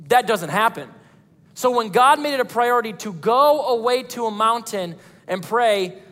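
normal talking voice

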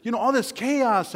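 high-pitched, bewildered questioning; troubled questioner. Acted. Listeners wondering why the virus happened, troubled. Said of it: "you might be thinking".

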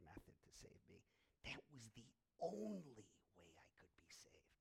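breathy whispered confession; whispered sermon line. Real, not acted. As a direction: drop to a hushed whisper. Preacher drops to a whisper.

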